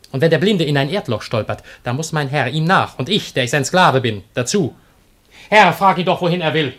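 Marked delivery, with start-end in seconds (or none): 5.5-6.7 higher and louder, pressing; urgent urging. Acted. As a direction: push the master to act. Slave urges his master loudly.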